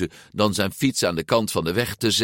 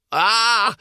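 high, loud shriek of alarm; scream; 5 dB louder than usual. A narrator's reading. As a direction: scream out in shock. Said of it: "gilde hij". (hoge gil)

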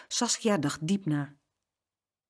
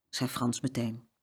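plain reading voice

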